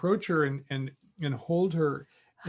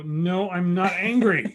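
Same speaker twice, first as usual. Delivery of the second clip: slow, louder and higher, anger leaking through; clearly angry denial; 4 semitones higher, 5 dB louder, slower than usual. Retold or imitated. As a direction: deny being angry while plainly irritated. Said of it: "Are you angry with me?".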